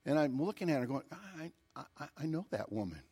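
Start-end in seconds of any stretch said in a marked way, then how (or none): 1.1-3.0 hesitant, very soft aside; puzzled undertone. Retold or imitated. Onlooker recognizing the woman walking up, puzzled undertone. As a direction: stammer it quietly to yourself. Retold muttering to himself in recognition.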